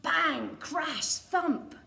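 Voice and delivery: loud, punchy sound words; bang crash thump. A narrator's reading. Reading the crash landing to a child, loudly. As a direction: boom out each landing word.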